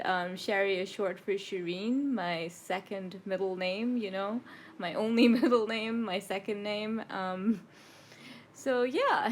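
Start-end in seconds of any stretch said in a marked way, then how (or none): none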